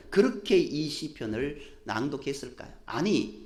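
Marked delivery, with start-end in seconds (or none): none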